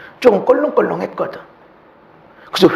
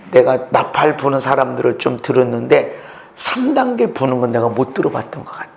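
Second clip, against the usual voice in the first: ordinary delivery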